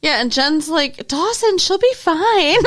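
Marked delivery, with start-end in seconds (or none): 1.1-2.6 high, drawn-out dismissal; hammed-up exasperated brush-off; mock whiny reassurance. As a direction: wave the worry away, high and stretched. Acts Jen dismissing Dawson's worry.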